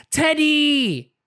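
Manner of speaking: high delighted shout of the name; high happy squeal; joyful cry. Acted. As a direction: cry out with joy at the found bear. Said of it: "cries Peppa".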